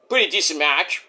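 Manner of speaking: ordinary delivery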